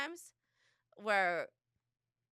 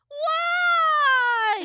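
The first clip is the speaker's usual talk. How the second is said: much higher, louder whiny shout; mock kid protest; 13 dB louder than usual. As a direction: wail it like an outraged child. Kids protesting music turned off.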